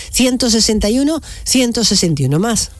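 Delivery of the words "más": ordinary delivery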